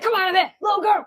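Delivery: high-pitched mimicking holler; mock yelling. Co-host mimics the man outside the door, mock yelling. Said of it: "This man just yelling".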